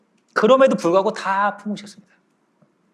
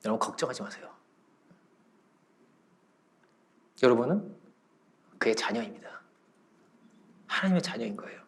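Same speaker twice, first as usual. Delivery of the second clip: hushed, low pastoral comfort; calm them almost in a whisper; gentle, quiet assurance with long pauses; soft reassurance; 7 semitones lower, 11 dB quieter than usual. Real, not acted. Preacher comforting the congregation.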